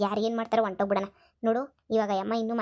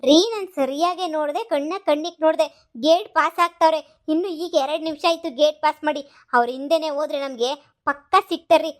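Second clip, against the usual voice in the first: agitated; 3 semitones higher, 4 dB louder than usual. Acted. Wife agitated about fleeing maids.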